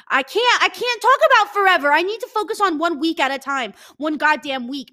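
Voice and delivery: high, loud exasperation; overwhelmed outburst. Real, not acted. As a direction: burst out in panic.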